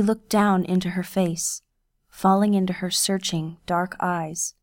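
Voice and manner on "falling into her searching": ordinary delivery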